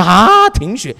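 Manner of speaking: loud, high shocked cry; father's stunned loud outburst; shocked shout. Retold or imitated. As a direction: blurt the word out in alarm.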